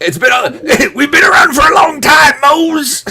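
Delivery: high, loud laughing cartoon crab voice; slipping into a Mister Krabs squeal through laughter. Real, not acted. Podcast host bragging about ten years, Mister Krabs squeal. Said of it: "Kinda sounded like Mister Krabs there".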